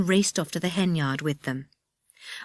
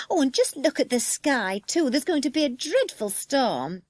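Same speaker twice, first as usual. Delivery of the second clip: high, fretful woman's voice; frightened woman; 9 semitones higher than usual. Acted. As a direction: fret aloud in a high voice. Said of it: "said the woman".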